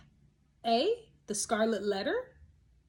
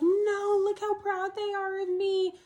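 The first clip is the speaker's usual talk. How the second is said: mock quote; 10 semitones higher, 4 dB louder than usual. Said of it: "She's just like".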